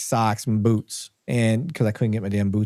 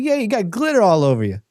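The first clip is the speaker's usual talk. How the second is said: higher-pitched mock Jersey accent; 8 semitones higher than usual. Cop quoting his partner in the flashlight beam, Jersey accent. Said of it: "He's got this huge Jersey accent".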